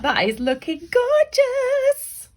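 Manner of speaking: high, slow, gushing praise; admiring. Real, not acted. Teacher gushing over her own drawing, slow and high.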